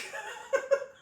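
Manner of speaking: very high-pitched burst of laughter. Real, not acted. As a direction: squeal with laughter. Laughing outburst.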